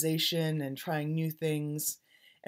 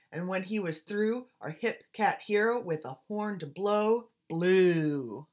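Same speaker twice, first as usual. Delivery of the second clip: put-on lively rhythmic read-aloud, like reading to children; story narration; 3 semitones higher, 4 dB louder than usual. A narrator's reading. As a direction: swing the rhymes like a jazz storyteller. Reads picture book aloud.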